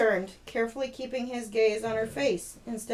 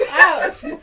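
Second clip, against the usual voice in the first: much higher, louder hammed-up cowboy howdy; cowboy accent; 13 dB louder than usual. Shown as a demonstration. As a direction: yell it like a cartoon cowboy. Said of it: "if I write a Western".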